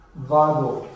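ordinary delivery

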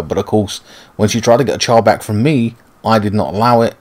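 ordinary delivery

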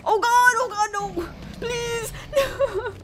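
high-pitched, helpless begging; squeaky game panic; panicked pleading. Real, not acted. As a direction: beg for mercy in a high squeal. Pleads as dragon attacks in game.

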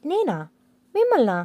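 high, wide-eyed feigned innocence; mock innocent surprise. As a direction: act shocked, pitch rising high. Wife feigns innocence to husband.

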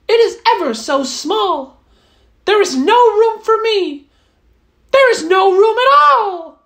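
high mouse voice, a touch disappointed; a small mouse turning down a crowded shoe; little mouse voice. Acted. Mouse rejecting the shoe, high and mildly let down.

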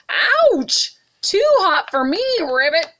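high, loud pained frog yelp; croaking ouch. Acted. Frog jumps into hot bathtub, high loud yelp. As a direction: yelp it out, scalded. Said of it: "he croaked".

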